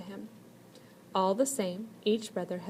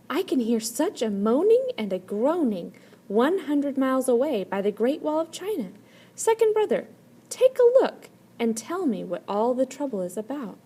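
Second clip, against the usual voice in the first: raised, louder voice of a worried brother; first brother calling out; 5 semitones higher, 6 dB louder than usual. A narrator's reading. First brother asks second brother to look, calling out. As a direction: call across to his brother, a bit higher. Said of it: "and said".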